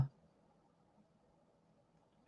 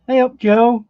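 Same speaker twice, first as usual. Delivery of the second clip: a louder, higher "Hey up, Joe"; calling out; 7 semitones higher, 6 dB louder than usual. Real, not acted. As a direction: call across the room to Joe. Greeting called out mid-lesson.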